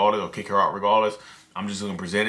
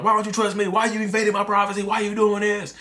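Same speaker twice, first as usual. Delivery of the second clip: mock raging partner, pitched up; raging accusation; 10 semitones higher than usual. Partner scolding the accuser in a mock rage. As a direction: act out a partner who turns the tables in a rage. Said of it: "go into a rage and scream like".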